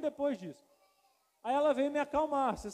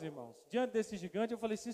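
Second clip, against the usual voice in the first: ordinary talk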